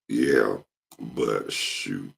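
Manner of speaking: ordinary talk, nothing marked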